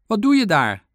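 high, loud called question; calling out. A narrator's reading. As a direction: shout the question down from above. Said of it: "riep de eekhoorn".

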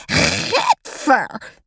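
high, revolted cry; disgusted yell. A narrator's reading. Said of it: "gilt juf Francine".

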